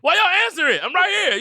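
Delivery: hammed-up indignant protest; mock protest. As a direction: object like you're being ignored. Protesting husband.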